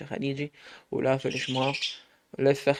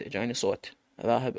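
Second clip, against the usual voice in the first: usual speaking voice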